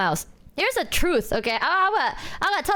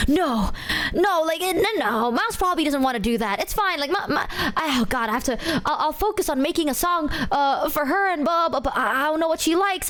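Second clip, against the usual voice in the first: mock flustered; 6 semitones higher than usual. Numi protesting, panicking.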